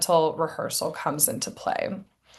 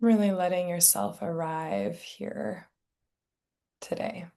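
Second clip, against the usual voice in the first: guided meditation; 7 dB quieter than usual. Real, not acted. Meditation teacher settling listeners into their bodies, soft guided meditation. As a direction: ease them gently into stillness, softly and unhurried.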